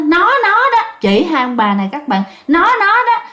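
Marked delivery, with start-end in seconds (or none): 0.1-0.8 high, sharp pointing accusation; a possessed man pointing at the couple, high accusing cry. Retold or imitated. 2.5-3.2 high, sharp pointing accusation; a possessed man pointing at the couple, high accusing cry. Retold or imitated.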